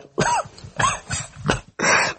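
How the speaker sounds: high, choked, heaving mock sobs; imitated heavy crying. Preacher shows how Jesus wept, imitated heaving sobs. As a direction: act out convulsive weeping in gasps. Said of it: "He was crying. He was doing".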